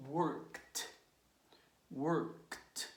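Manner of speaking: "worked," as one syllable, work plus a crisp T; voiceless T ending. Shown as a demonstration. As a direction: release KT at the end of "worked," with air only. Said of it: "and you pronounce like".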